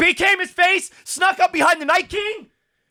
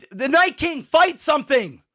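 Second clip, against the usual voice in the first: normal talking voice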